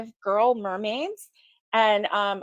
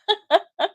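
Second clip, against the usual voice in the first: short laugh; 10 semitones higher, 3 dB louder than usual. Real, not acted. Laughs at own remark.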